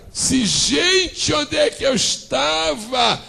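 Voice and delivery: high, slow, shouted tears of joy; mother in the desert discovering the spring, shouting and weeping; Agar's joyful cry. Acted. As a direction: cry out in disbelief at the water. Agar finds the spring, crying out.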